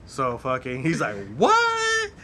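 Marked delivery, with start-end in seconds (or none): 1.4-2.1 high and loud, a stunned cry of disbelief; shocked what. Retold or imitated. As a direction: yelp it out in shock. Friend's shocked reply retold.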